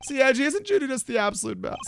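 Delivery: put-on high, eager young guy's voice; Larry voice. Larry denies staring, in his high voice. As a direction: pitch it up like a flustered buddy.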